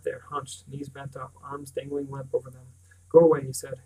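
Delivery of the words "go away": sharp loud "go away"; a boy snapping at his friend to leave; angry outburst. A narrator's reading. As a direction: push him off, loud and bitter. Bran angrily dismisses Will.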